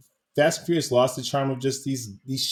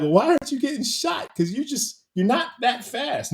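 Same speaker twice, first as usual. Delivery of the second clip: high-pitched incredulous questioning, half joking; playing the baffled viewer, pitched way up; mock disbelief; 11 semitones higher than usual. Podcast host mocks Bruce Willis outrunning gunfire, high incredulous tone. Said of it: "Now I'm like".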